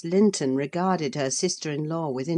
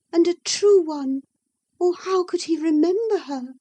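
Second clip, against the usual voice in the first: high, louder, plaintive and defensive; cling to her hope; distressed young woman; 13 semitones higher, 6 dB louder than usual. Acted. Isabella defending Heathcliff to Nelly, high and distressed.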